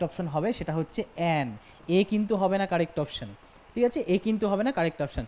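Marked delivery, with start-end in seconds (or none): none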